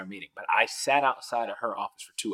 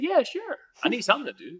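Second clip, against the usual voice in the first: much higher and eager, jumping at it; a squeaky keen yes; 12 semitones higher, 3 dB louder than usual. Retold or imitated. Answering the VP of D E and I, with an eager high yes. Agreeing to mentor groups.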